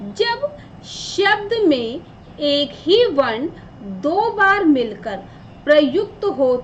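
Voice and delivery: very slow, word by word; slow dictation. Shown as a demonstration. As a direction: dictate it slowly for notes. Teacher dictates the definition. (धीरे-धीरे लिखवाते हुए)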